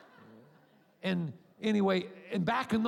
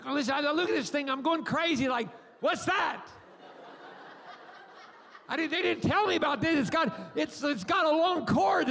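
mock panic; 8 semitones higher than usual. Panicked new father retelling.

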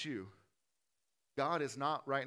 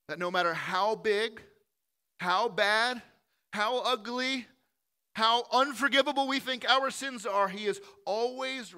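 rising higher and louder with each phrase; building preaching; 7 semitones higher, 8 dB louder than usual. Real, not acted. Preacher pressing the congregation, climbing in pitch and volume.

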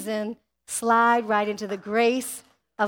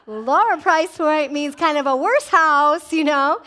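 louder, slowed, arguing back; loud protest; 3 semitones higher, 6 dB louder than usual. Retold or imitated. Preacher retelling how he argued with God, loud protest. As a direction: push back at God, exasperated. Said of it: "I was like".

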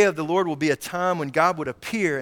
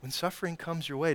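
normal talking voice

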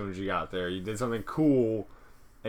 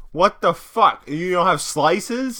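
mock outrage; 8 semitones higher, 9 dB louder, slower than usual. Retelling outrage at pizza shop.

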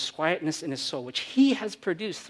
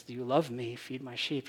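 tender question; 4 semitones lower, 8 dB quieter than usual. Acted. Jesus to Peter, tender.

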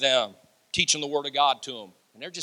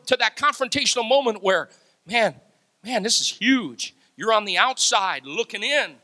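ordinary talk, nothing marked

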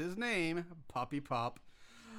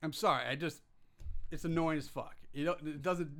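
normal talking voice

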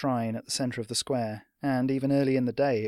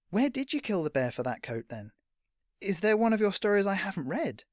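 high, curious lad's voice; young fan, wide-eyed curiosity; eager boy; 8 semitones higher than usual. Acted. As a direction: press your hero with keen questions. Boy keenly questions his hero.

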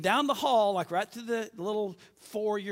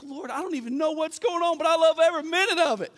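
higher, louder tearful cry to the Lord; crying prayer; 9 semitones higher, 7 dB louder than usual. Retold or imitated. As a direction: pray through sobbing joy. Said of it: "And I'd just start crying".

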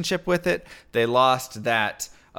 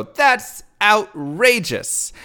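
slow, higher, louder mock outrage; outraged; 6 semitones higher, 5 dB louder, much slower than usual. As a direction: drag it out, scandalised by the price. Diner reacting to lobster price.

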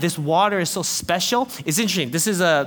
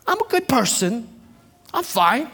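mock self-righteous; 5 semitones higher than usual. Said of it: "they felt righteous".

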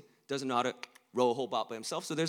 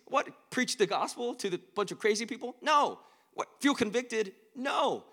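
indignant Jonah; 10 semitones higher, 4 dB louder than usual. Acted. Jonah's inner objections acted out.

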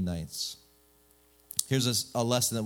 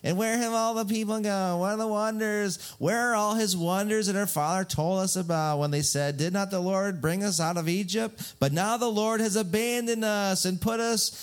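hammed-up whining complaint; whiny; 8 semitones higher than usual. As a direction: whine in protest like a wronged kid. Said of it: "he kinda gets all whiny".

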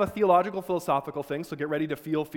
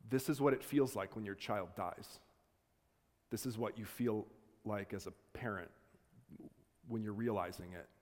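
dread-filled inner thought; 4 semitones lower, 8 dB quieter than usual. Retold or imitated. Inner thought of dying child.

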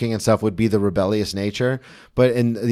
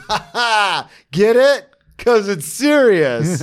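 mock audience; 8 semitones higher, much slower than usual. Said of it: "everyone's like".

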